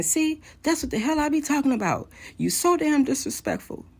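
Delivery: high-pitched heated rant; retold tirade, pitched well up; imitating angry yelling. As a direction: act out an angry caller's scolding. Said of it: "He proceeds yelling, being angry".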